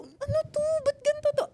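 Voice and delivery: very high, bewildered retold reaction; mock-panicked questioning; dismayed. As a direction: pitch it way up in comic dismay. Retold shock on reading the script.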